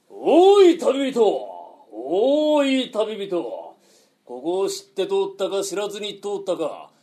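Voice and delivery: loud, rolling highwayman's challenge; bandit's challenge. Shown as a demonstration. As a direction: declaim it like a robber stepping out. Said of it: "バッと飛び出てこう声かけんだ".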